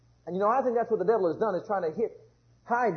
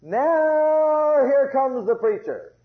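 louder, higher and slow, hammed-up herald; mock preacher entrance; 6 semitones higher, 7 dB louder, much slower than usual. Preacher mock-announcing himself to the congregation, hammed-up and slow.

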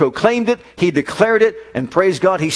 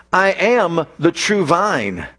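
slow; much slower than usual. Acted. Slow emphatic declaration.

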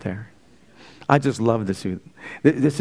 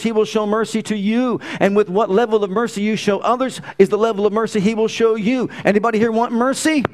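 preaching at full force; 5 semitones higher than usual. Acted. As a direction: preach it with fire.

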